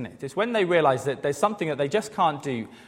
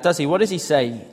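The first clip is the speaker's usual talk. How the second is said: ordinary talk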